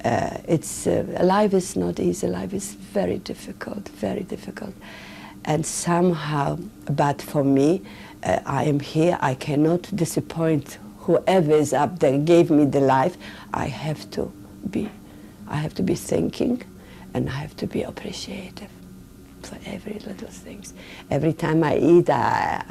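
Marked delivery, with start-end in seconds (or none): 2.3-4.7 whispered, softer, heavy with feeling. Real, not acted. Reflecting on hardship. 13.5-20.7 hushed resolve. Real, not acted. Resolving to be grateful.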